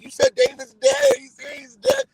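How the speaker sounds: high, loud, hammed-up cry; mock hysterical. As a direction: imitate someone frantic and near tears. Retold panicked misunderstanding about a death.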